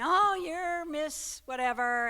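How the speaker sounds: imitating Steve reading the winner's name; mock host announcement.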